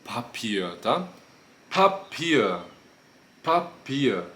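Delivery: Papier with an aspirated P puff; explosive P. Shown as a demonstration. Teacher demos aspirated P in Papier. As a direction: push air out hard on the P of Papier. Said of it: "peguei um papel".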